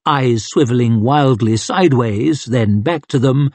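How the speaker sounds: usual speaking voice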